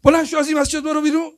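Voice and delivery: loud, high, fast scolding yell; retold shout. Old man yelling at beggar to leave the mosque, loud and fast. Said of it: "داد زد".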